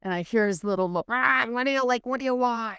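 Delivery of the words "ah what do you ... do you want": higher-pitched mock grumble; annoyed sleepy reply imitation. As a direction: imitate someone disturbed by knocking. Imitating son woken by knocking.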